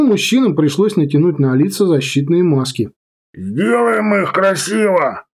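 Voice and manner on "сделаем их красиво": muffled, slow and higher; through a respirator. Acted. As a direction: speak muffled behind a gas mask. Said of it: "Голос из-под респиратора звучал так же невнятно".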